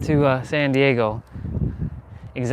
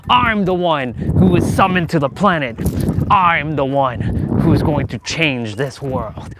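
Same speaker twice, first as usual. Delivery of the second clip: defiant proclamation to God; 4 semitones higher, slower than usual. Retold or imitated. Shouting to God from the swing, slow grandiose proclamation. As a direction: proclaim it like a chosen one, slowly. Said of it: "talking like this to God".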